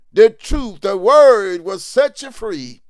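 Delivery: loud, high preacher's cry; full-force sermon shout; fiery preaching. Real, not acted. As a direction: drive it home to the congregation.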